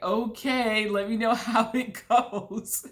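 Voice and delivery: drawn-out okay, ending in a laugh; casual retold send-off. Younger brothers shrugging off the skydive, mock-casual.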